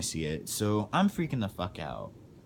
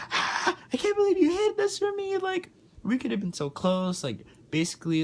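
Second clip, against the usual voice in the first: much higher, louder mock mom outburst; mocking upset mom; 3 dB louder than usual. Mom getting mad.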